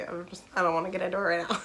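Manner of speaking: fast, tearful words breaking off; choking up. Real, not acted. Avoiding heavy content warnings, real tearful catch. Choked up about favourite book.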